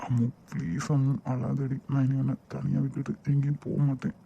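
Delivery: soft, gentle reassurance to his crying wife; tender comfort. Acted. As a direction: promise it softly. Husband comforting weeping wife. (மென்மையான ஆறுதல்)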